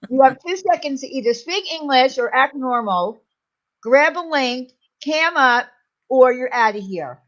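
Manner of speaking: loud, insistent order; stern ultimatum. Real, not acted. Streamer giving a chatter two seconds to behave, stern and loud. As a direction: lay down the law, loudly. Ordering viewer to act normal.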